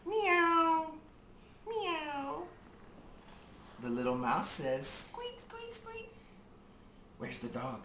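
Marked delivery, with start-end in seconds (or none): 0.1-2.4 cat meow. Pretend. Animal sound. 4.9-6.1 very high, soft squeak; tiny mouse squeaking. Pretend. Animal sound.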